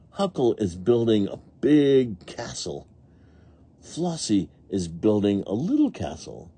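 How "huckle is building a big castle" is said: ordinary delivery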